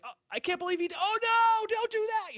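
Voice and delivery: high-pitched, hammed-up cry of dismay; mock horrified yell. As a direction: wail it like a fan watching disaster unfold. Acting out exasperated Mayfield watcher.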